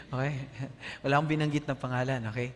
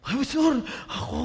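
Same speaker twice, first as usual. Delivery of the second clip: high, loud, panicky squeal; nervous laughing protest; flustered congregant; 9 semitones higher, 4 dB louder, faster than usual. Retold or imitated. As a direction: play the startled member caught off guard. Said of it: "ikaw ang mag-lead ng prayer mamaya".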